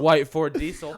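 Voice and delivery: loudly blurting it out. Acted. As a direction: shout the phrase. Blurted catchphrase.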